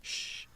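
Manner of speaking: very soft shush; low, drawn "shh"; hushing. Real, not acted. Hushing a noisy baby.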